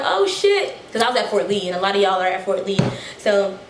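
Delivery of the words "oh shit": surprised. Retold or imitated. Surprised at being recognized.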